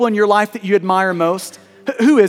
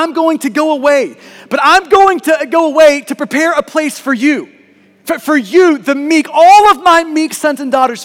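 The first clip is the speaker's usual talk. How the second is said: preaching at full force; 5 semitones higher, 4 dB louder than usual. Acted. Pastor preaching to the congregation, rising and louder. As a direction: drive the point home from the pulpit.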